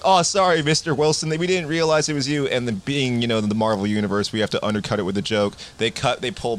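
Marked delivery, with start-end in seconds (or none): 0.0-2.5 fake-sorry officer backing off; mock sheepish cops. Cops apologizing to Sam, sheepish and higher. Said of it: "And they're like".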